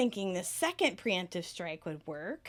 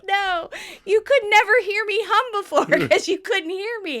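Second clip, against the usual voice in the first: high, giggling through the protest; laughing protest; 10 semitones higher, 7 dB louder than usual. Retold or imitated. Telling coworker she always hums, laughing. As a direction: laugh while defending yourself. Laughing retelling own reply.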